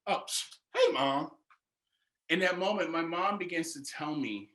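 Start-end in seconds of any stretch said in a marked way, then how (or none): 0.1-1.3 sudden much higher startled greeting; surprised. Retold or imitated. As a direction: caught off guard, voice jumps up. Surprised mom is calling.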